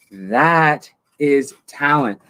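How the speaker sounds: each word spaced and weighty; slow deliberate punchline. Acted. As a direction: draw it out slowly. Mock proud punchline.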